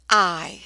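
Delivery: teacher isolating the long I in I; long I sound. Shown as a demonstration. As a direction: sound out I as a full long vowel. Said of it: "it's going to say the long I sound".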